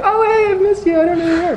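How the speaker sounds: very high mock-gushing reply; fake-friendly gushing. Greeting someone you had been avoiding, high and fake-friendly. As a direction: put on a high, insincere social squeal.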